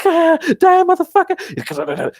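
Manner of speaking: high, loud mock battle cry; retold frantic high yell; panicked attack. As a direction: scream it while attacking, hammed-up. Retelling spray attack on spider.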